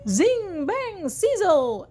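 high, stretched-out magic words; storybook spell effects; magical exclamation. A narrator's reading. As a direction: draw out each sound word with wonder. Magic transformation sound words.

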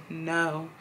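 very soft, low no; meek reply. Retold or imitated. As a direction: answer sheepishly, barely voiced. Meek answer to the manager.

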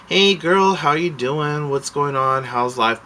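high, loud hello easing down; retold peppy small talk; chummy greeting. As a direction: greet a girlfriend across the store.

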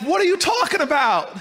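high, loud bewildered protest; confused cry. Retold or imitated. Young Booker runs after Miss Jones, high and loud. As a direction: call out in confusion.

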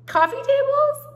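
high, slow, disbelieving rise; incredulous. Real, not acted. Mocking her own travertine purchase, high and slow in disbelief. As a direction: drag it out in horrified disbelief.

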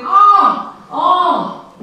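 two high, loud cries; loud "Ah!" ringing twice; echoed scream. A narrator's reading. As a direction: scream it out, then let the repeat drop slightly. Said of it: "of his scream".

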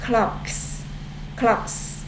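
"Clocks" whispered with a sharp s at the end; hissed s ending. Shown as a demonstration. Teacher showing pupils the s ending. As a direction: hiss the s of "Clocks". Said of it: "Đây là phát âm số một".

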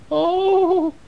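very high startled little mouse; mouse gasp. Acted. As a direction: squeal it like a scared mouse. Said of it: "said the mouse".

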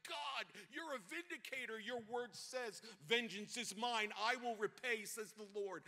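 high, loud cry to God; impassioned prayer. Retold or imitated. Pastor crying out to God, high and impassioned. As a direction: lift the plea up high and strong. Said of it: "And I'm like".

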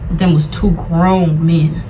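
ordinary delivery

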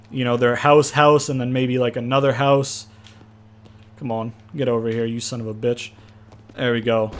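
ordinary delivery

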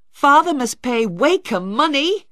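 high, loud disbelieving shout. Acted. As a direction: shout it in shock at the news. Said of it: "Tom shouted".

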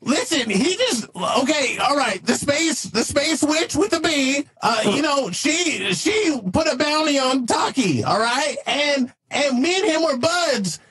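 flustered AI sidekick. Acted. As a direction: fumble through an explanation as an eager AI. Plays Leroy the AI sidekick.

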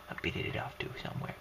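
soft and fast whisper. Real, not acted. To viewers, joking about his heart, fast whisper. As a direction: slip it out as a sly whisper.

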